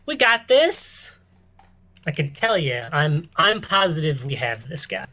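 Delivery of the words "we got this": slow, much higher fake bravado; mock confident. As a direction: oversell it, barely holding a laugh. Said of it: "can't even say it with a straight face".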